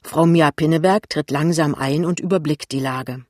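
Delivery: plain reading voice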